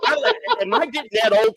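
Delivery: high voice breaking into laughter; laughing self-reproach. Retold or imitated. Owner wondering if he's getting old, laughing self-reproach. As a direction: laugh at yourself, pitched high.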